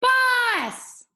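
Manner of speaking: "Boss" shouted very high and loud, falling; child screaming "Boss" for his dog; shouted name. Shown as a demonstration. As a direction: call "Boss" across the yard. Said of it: "Try that with me".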